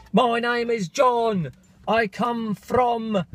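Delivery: high, slow put-on singer voice; imitating singer. Vlogger mimicking the singer from the album. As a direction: drawl it like the band's frontman introducing himself.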